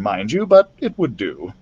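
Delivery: usual speaking voice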